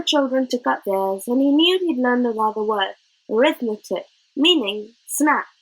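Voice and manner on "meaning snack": usual speaking voice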